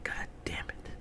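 soft whispered god damn it; whispered frustration; whispered curse. Retold or imitated. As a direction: curse under your breath. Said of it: "I'm like".

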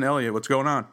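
usual speaking voice

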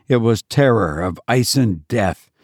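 ordinary delivery, nothing marked

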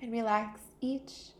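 calm meditation voice with long pauses; guided meditation. Real, not acted. Teacher easing listeners into a body scan, calm guided meditation. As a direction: guide them unhurriedly into stillness, pausing between phrases.

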